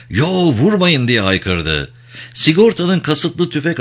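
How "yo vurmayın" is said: raised, higher-pitched plea; urgent, moderately acted shout; shouting at full voice. A narrator's reading. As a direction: cry out to stop them, pitch up. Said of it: "Craig avazı çıktığı kadar".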